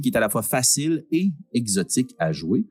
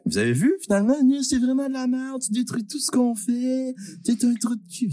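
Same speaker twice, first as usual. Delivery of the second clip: mock angry rant; 13 semitones higher than usual.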